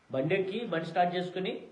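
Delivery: ordinary delivery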